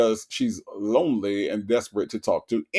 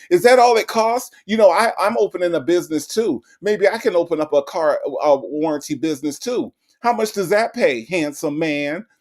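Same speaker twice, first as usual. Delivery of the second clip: flirty mock Sonya; 4 semitones higher than usual. Host imitating Sonya gushing at the scammer, high and eager. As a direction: gush at the caller, pitched up. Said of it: "That's how she would answer".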